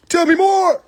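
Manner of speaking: high, eager put-on voice; mock eager viewer. Viewer settling back with popcorn, in a high hammed-up plea.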